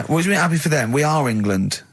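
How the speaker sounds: usual speaking voice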